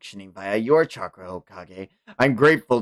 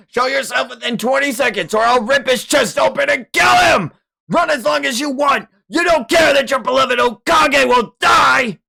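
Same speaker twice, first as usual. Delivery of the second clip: much higher, louder roaring threat; furious threatening shout; 13 semitones higher, 6 dB louder than usual. Acted. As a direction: bellow the ultimatum to scare him out. Villain threatens hiding boy.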